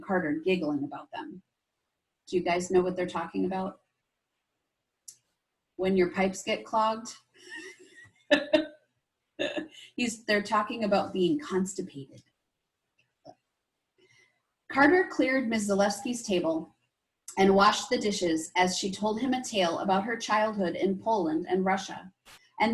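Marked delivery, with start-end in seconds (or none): none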